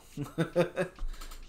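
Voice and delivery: breathy little laugh. Real, not acted. Laughs at own joke.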